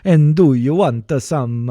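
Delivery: slow, loud Swedish singsong; exaggerated Swedish train rhythm; Swedish accent. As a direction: echo the tutorial's Swedish lilt. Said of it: "how you do a Swedish accent".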